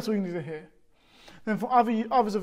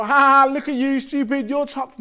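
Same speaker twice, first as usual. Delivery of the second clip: a louder, slightly higher sneering jeer; mock taunt; 5 semitones higher, 7 dB louder than usual. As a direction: sneer at someone trapped, half in jest. Imagined God mocking Israelites.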